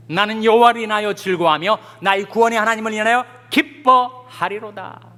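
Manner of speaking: loud, high proclamation of scripture. Acted. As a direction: declare the verses forcefully to a congregation. Recites scripture to congregation. (힘차게 선포하듯 성경 구절 낭독)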